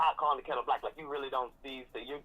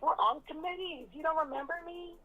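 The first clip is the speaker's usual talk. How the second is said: high-pitched imitation of an eager acquaintance; mock needy pleading; 13 semitones higher than usual. Castmate imitating Wendy chasing Karen's attention, high mock pleading. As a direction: mimic someone begging to be remembered, voice pitched way up.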